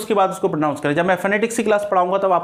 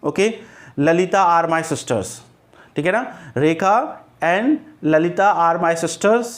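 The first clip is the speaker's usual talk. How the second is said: drawn out, slow example; slow example sentence; much slower than usual. Shown as a demonstration. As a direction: read the example slowly to the class. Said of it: "जैसे मैंने कहा कि". (धीमे से उदाहरण वाक्य)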